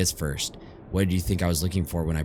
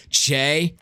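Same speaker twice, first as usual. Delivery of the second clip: calling out; 9 semitones higher, 5 dB louder than usual. Acted. Calling after someone signing off.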